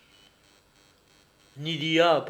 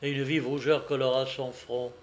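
plain reading voice

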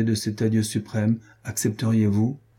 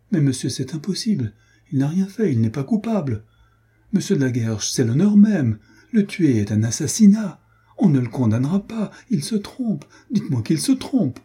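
desperate pleading; 6 semitones higher than usual. A narrator's reading. Adrienne begging Arnold to deny the sentence, high desperate plea. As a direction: beg as if his life depends on it.

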